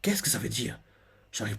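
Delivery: soft, hushed inner voice; inner thought. Retold or imitated. Bonnie wondering to herself why she can't reach him, hushed. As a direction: think it to yourself, barely aloud. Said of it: "se dit dans sa tête".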